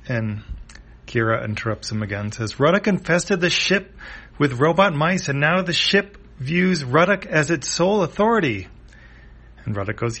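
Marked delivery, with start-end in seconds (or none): 2.6-8.7 high, loud indignant complaint; acted cartoon exasperation; exasperated outburst. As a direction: rant an explanation mid-chase. Kira interrupts, ranting about Ruddock.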